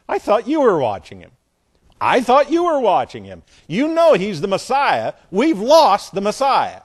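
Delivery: loud, high-pitched comic blaming; mock parental panic. Mary and Joseph arguing over the lost boy, hammed-up blaming. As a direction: scold your spouse in hammed-up alarm.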